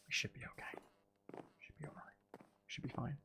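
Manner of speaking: soft whispered self-reassurance. Real, not acted. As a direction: breathe it out under your breath to yourself. Reassuring self while checking base.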